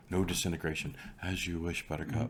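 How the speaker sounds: a quiet, softened take on the pirate's line; Dread Pirate Roberts. Retold or imitated. Friend riffing on Star Wars, hushed Dread Pirate Roberts quote. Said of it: "he's the Dread Pirate Roberts".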